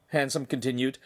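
usual speaking voice

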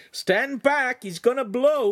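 high, loud alarm; shouted warning. A narrator's reading. Lincoln warns everyone away from the enactor, shouting. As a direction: yell to clear the area. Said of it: "Lincoln shouted".

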